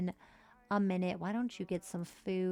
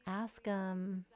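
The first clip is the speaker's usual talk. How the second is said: usual speaking voice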